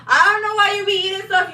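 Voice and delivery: high, loud reproach to a pet; exasperated scolding. Real, not acted. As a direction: burst out in exasperation at the dog. Repeats scolding of dog, escalated.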